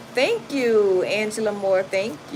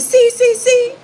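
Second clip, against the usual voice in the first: much higher, louder tattling; mimicking the man; 11 dB louder, slower than usual. The man telling the officer, high loud squeal. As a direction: squeal see like a snitch. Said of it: "He said".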